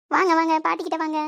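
high, loud, doting call; grandmother's cooing invitation; coaxing a child. Acted. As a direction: beckon a toddler over eagerly. Grandmother calls the child to her.

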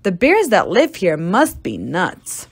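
ordinary delivery

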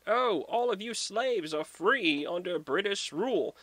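lightly mocking, high-pitched proclamation; proclaiming soldier. British soldiers announcing freedom to farm slaves, mock proclamation.

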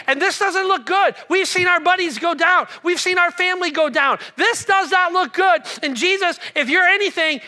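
loud frantic plea; panicky fishermen. Retold or imitated. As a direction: fishermen begging Jesus over the waves. Disciples voiced panicking on boat.

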